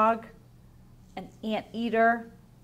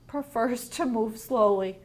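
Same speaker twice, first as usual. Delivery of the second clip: ordinary talk, nothing marked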